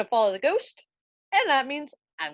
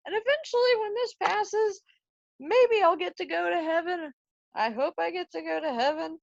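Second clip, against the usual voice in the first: much higher, wistful hopeful lilt; hopeful ghost; 7 semitones higher than usual. Acted. Ghost telling Hamlet about purgatory, high hopeful lilt. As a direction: float it up, dreaming of heaven.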